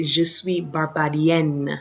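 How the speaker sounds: Je suis Barbadienne, said slowly with a clear final n; feminine ending sounded. Shown as a demonstration. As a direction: make the n in Barbadienne ring out. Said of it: "You can hear that N".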